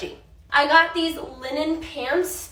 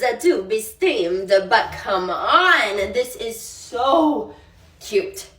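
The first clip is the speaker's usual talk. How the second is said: drawn out; much slower than usual. Real, not acted. Slow drawn-out admiration.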